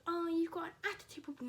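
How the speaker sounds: high, loud imitation of a scolding teacher; exaggerated high-pitched scolding; mocking teacher.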